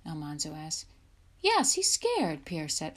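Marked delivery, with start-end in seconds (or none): 1.4-2.4 high, slow singsong taunt. A narrator's reading. Pierre teases Louis for being scared, taunting. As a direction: draw it out high and teasing.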